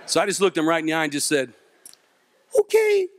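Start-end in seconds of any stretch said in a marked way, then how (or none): 2.6-3.1 very high, meek squeal; squeaky okay. Acted. Scared man answering the big dude's friend, high meek squeak. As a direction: give in with a tiny high squeak.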